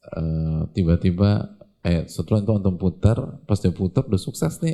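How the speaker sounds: ordinary talk, nothing marked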